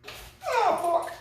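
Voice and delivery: sudden cry of pain; pained yelp. Real, not acted. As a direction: yelp as the finger gets pinched. Finger pinched by ratchet.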